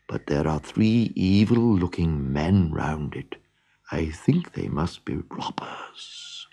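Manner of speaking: soft, whispered warning; whispering donkey. A narrator's reading. Donkey whispering to friends about the robbers. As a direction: breathe the news so the robbers can't hear. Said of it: "whispered the donkey".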